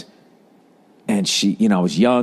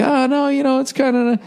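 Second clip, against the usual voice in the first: mock backpedaling. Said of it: "try to pull back on the stick".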